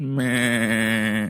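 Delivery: lightly done Family Guy laugh; a hint of Peter Griffin. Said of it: "That was my Peter Griffin".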